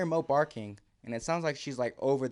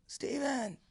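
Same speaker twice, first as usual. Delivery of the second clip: thin, high strained call; a scream that barely comes out; weak scream; 14 semitones higher than usual. Retold or imitated. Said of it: "I scream, but all that comes out is".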